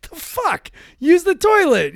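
high, loud mock outrage; acted incredulous outburst; exasperated parent shout. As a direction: yell like a parent at wit's end.

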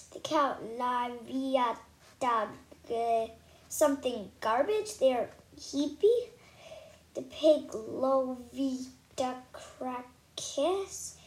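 slow nonsense mumble, guessing words; stumbling slow guessing. Acted. Girl faking through unreadable words, drawn-out guessing. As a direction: fake the words you cannot read, slowly.

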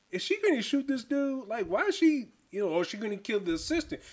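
high, shocked asking; stunned, half-whispered disbelief. Retold or imitated. Retold tense moment, high pitch.

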